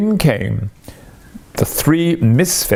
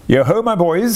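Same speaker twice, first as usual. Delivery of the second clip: put-on hearty high call to the boys; rally the lads cheerfully; jovial Fezziwig call; 7 semitones higher than usual. A narrator's reading. Fezziwig calling his apprentices, jolly hearty call.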